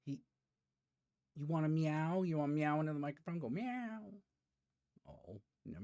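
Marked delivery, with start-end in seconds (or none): 0.1-4.0 coaxing the cat. Real, not acted. Streamer coaxes his cat to meow into the mic, high baby talk. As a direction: coax a meow from the kitty.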